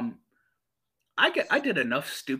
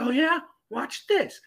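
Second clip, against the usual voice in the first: high-pitched imitation of a sibling's dare; challenging brother; 13 semitones higher than usual. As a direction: mimic a brother one-upping you, much higher. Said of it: "He's like".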